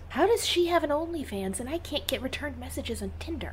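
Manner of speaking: secret whisper to a friend. Acted. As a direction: breathe it with no voice. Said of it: "turns to Bradley and whispers".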